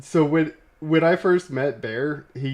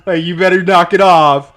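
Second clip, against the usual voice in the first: louder, half-laughing mock threat from a big boss; mock stern warning; 4 semitones higher, 8 dB louder than usual. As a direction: lean in like a boss putting a rebel in line, playfully. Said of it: "Bear's like".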